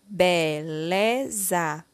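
BELEZA drawn out slowly as be, le, za; syllable by syllable. Shown as a demonstration. Teacher completing the word with syllable lé, slowly syllable by syllable. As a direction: sound out BELEZA one syllable at a time. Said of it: "Iremos utilizar a sílaba lé".

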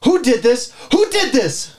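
high-pitched outraged demand; indignant accusing question; angry demand. Acted. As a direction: demand the culprit, pitch jumping up. Angry confrontation over magazine.

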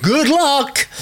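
very high, theatrical send-off; mock-cheery good wishes. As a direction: sing it out in mock encouragement. Acting out a listener's written comment.

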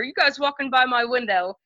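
ordinary delivery

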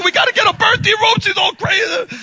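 fast, high, loud mock panic; going crazy. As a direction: imitate a frantic nurse shouting orders. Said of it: "she starts going crazy".

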